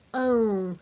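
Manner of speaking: "ão" said as a clear, isolated nasal diphthong; nasal diphthong demo. Shown as a demonstration. Teacher modelling the nasal diphthong for students, clearly articulated. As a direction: model "ão" slowly and nasally for the class. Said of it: "no ditongo nasal".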